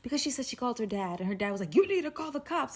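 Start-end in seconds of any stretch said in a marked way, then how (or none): 1.8-2.8 higher, quicker retold dad; urgent dad. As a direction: lightly voice an insistent dad. Voices her dad giving orders.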